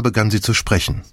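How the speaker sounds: plain reading voice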